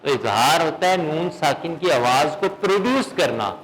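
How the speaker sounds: ordinary talk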